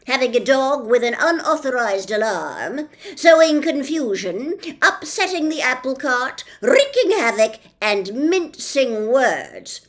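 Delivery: loud, slowish officious policeman; pompous policeman. Acted. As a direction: lay down the law with bluster. Policeman lists crimes.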